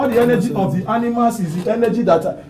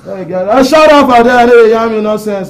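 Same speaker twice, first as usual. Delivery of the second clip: angry shout; 4 semitones higher, 10 dB louder than usual. Acted. Telling a girl to shut up, a furious shout. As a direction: yell it, much higher and louder.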